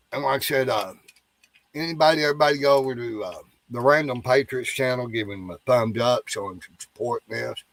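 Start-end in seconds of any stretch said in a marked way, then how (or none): none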